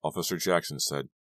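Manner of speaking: ordinary delivery, nothing marked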